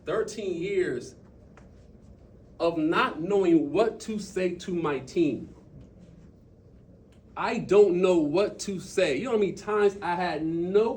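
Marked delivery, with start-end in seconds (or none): 0.1-9.2 slow, deliberate, building louder; slow emphatic. Real, not acted. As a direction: drive each word home slowly.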